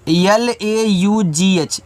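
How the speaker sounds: slow letter-by-letter spelling L-A-U-G-H of एल ए यू जी एच; teacher spelling for children; spelled out slowly. Shown as a demonstration. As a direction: spell एल ए यू जी एच one letter at a time. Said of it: "इसको पढ़ते हैं लाफ".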